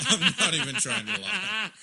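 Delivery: can't stop laughing. Real, not acted. Cracking up mid-sentence.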